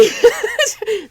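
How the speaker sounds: high-pitched laugh; burst of laughter. Real, not acted. Co-host hears the cat explanation, high burst of laughter. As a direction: crack up, pitch shooting up.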